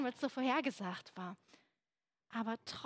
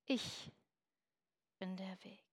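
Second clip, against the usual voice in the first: much softer, dropping low on "bin der Weg"; hushed solemn quote; 4 semitones lower, 8 dB quieter than usual. Acted. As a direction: let "Ich" hang, then breathe "bin der Weg" quietly. Repeating Jesus' famous verse.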